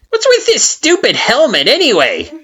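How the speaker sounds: very high, loud jeering flower voice; mocking Flowey. A narrator's reading. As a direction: rib her about the helmet. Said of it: "asks Flowey".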